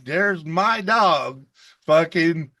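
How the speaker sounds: ordinary talk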